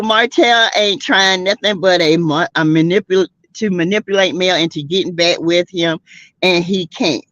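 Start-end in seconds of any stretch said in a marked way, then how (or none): none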